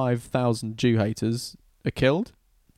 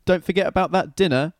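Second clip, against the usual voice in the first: calling a reminder; 6 semitones higher than usual. Acted. Someone calls Haman with a reminder.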